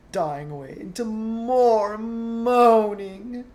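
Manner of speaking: high, loud, slow moan. Acted. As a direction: moan the words out. Narration acts moaning.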